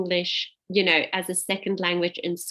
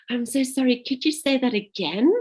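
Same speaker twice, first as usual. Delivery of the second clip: higher-pitched, mildly apologetic plea; retold apology; 6 semitones higher, 3 dB louder than usual. New receptionist asking a caller to repeat, apologetic. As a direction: re-enact a polite, apologetic request to repeat. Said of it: "I would just be like".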